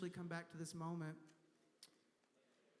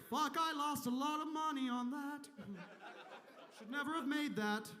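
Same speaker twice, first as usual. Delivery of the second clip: higher, annoyed God voice; mock God grumbling; 4 semitones higher than usual. Comic voicing God's crypto loss, higher and grumbling. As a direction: grumble like a god who lost money. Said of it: "He's like".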